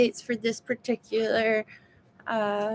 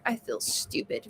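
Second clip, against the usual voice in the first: whispered, much softer; 9 dB quieter than usual. Retold or imitated. Retelling what she told her doctor, in a whisper. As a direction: breathe it out as a hushed confession. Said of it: "That's what I told my doctor".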